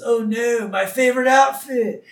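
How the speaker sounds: high, loud mock wail; mock dismay. [name] lamenting his favorite outfit, mock dismay.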